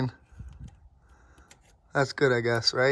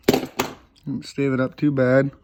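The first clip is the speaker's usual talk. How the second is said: ordinary talk, nothing marked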